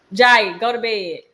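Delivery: loud, slow bedtime command; firm parental order. Real, not acted. Orders child to bed.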